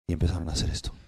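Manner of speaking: usual speaking voice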